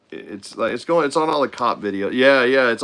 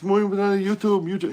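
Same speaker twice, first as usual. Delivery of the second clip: higher, pleading in broken Spanish; pleading YouTuber; 9 semitones higher than usual. Retold or imitated. YouTuber begs airport staff, higher mock pleading. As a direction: beg with mock importance.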